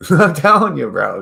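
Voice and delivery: high, loud laughing insistence; laughing outburst. Real, not acted. Insisting to viewers, loud laughing burst. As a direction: laugh it at the crowd. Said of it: "laughing loud outburst".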